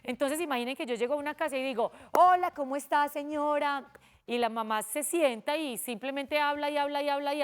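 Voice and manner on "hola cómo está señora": "Hola" alegre, más agudo y fuerte; saludo de visita. Shown as a demonstration. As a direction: saluda animada a la señora. Maestra actúa visita a una casa. (saludo cordial de visita)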